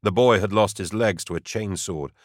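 usual speaking voice

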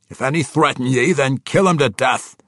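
high, loud dwarvish command; dwarf commander ordering. A narrator's reading. King ordering his battle captain, loud and forceful. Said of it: "he ordered Ragged Dane".

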